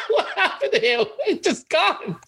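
very high, laughing through the words; helpless laughter. Real, not acted. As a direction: crack up in real disbelief as you talk. Guest suddenly vanishes from the call.

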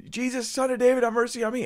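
high-pitched desperate yell; crying out. Acted. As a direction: call out at a high pitch to someone far off. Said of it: "the guy's crying out".

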